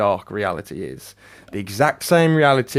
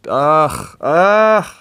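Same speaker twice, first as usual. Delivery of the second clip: two loud groans, rising higher; disgusted groan; 5 semitones higher, 7 dB louder than usual. Real, not acted. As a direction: groan louder and higher the second time.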